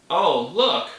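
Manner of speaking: ordinary talk, nothing marked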